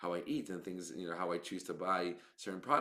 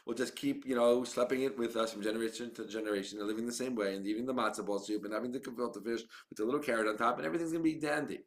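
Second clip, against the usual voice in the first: mock old-timer; 3 semitones higher, 4 dB louder than usual. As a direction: ham up the stubborn traditionalist waving it off. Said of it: "it's like".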